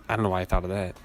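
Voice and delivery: ordinary delivery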